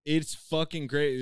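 ordinary talk

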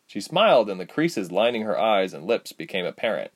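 ordinary delivery

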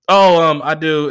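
a touch of a caught, hesitant answer; mock flustered. Comic replaying his own awkward dodge, a bit flustered. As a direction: stall like you've been put on the spot. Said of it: "I'm like".

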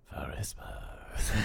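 slow, breathy echo in a whisper; whispered name. Pretend. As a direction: whisper it long, like a mysterious reveal. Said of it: "Never said your name like I say that".

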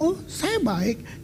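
soft, drawn-out put-on answer; mock reassurance. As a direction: play someone softly insisting they're fine. Acting a sick person's answer.

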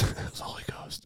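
awed mock-reverent whisper. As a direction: hush it like church. Hushed reverent joke.

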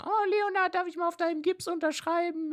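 very high falsetto schoolmate; girly fan voice. Retold or imitated. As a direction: coo it like an admiring classmate. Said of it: "ich hab die Aufmerksamkeit genossen".

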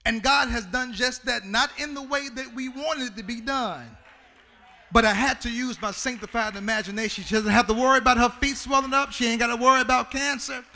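high, loud sung preaching climax; preacher celebrating the healed mother in song; preaching at full force. Real, not acted. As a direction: whoop it out in celebration. Climax of preaching over organ.

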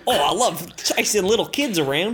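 gleeful hammed-up Australian; Australian accent. Babysitter character enjoys chasing kids, loud Australian accent. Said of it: "I was doing an Australian accent".

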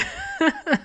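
high-pitched amused laugh; real laugh. Real, not acted. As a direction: burst out laughing helplessly. Laughs at dad's odd remark.

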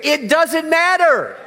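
high, loud, slow congregational call; slow rallying call. Shown as a demonstration. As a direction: say it out for all to hear. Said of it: "Turn to your neighbor and say".

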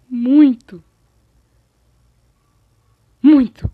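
sudden emphatic loud bursts; startlingly loud. Real, not acted. Vlogger stressing how much it hurt, startlingly loud. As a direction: punch each word out loud enough to startle. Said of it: "eu acho que eu te dei um susto".

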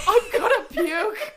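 laughing, slow and disgusted. Real, not acted.

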